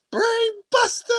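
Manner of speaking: drawn-out, high-pitched announcer yell; high imitation commentator shout, stretched out; Michael Cole yell. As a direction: yell it like a commentator calling a big move. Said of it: "Michael Cole yelled".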